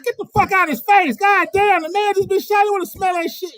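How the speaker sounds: high, loud angry yell at a friend. Retold or imitated. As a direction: chase her off furiously. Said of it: "I remember Dee Dee saying".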